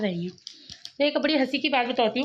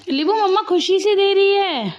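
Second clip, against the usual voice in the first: coaxing; 7 semitones higher, 5 dB louder than usual. Real, not acted. Mother coaxing daughter to eat.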